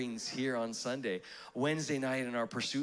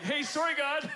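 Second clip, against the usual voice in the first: high-pitched mock apology; apologetic Canadian; 12 semitones higher, 4 dB louder than usual. Polite Canadian interrupting God, mock-apologetic. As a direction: pop up with a comically polite sorry. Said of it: "That's how he knows it's a Canadian".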